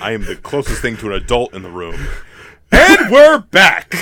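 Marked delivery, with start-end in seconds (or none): 2.7-3.9 slow, booming show comeback; announcer shout. Acted. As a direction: stretch it out like a host. Show returns from break.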